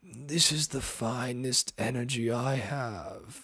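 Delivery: slow, soft mock English accent; put-on English spirit voice, slow and hushed; Doctor James's English accent. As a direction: imitate a channeled English doctor, softly drawn out. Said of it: "and she was like".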